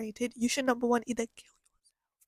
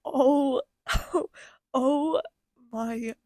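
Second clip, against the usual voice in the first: loud, tearful moaned oh; overwhelmed wail; 6 dB louder, much slower than usual. Real, not acted. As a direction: cry out, overcome with feeling. Overcome praising the film.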